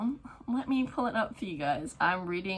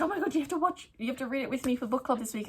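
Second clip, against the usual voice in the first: eager friend; 5 semitones higher, faster than usual. Retold or imitated. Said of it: "So she was like".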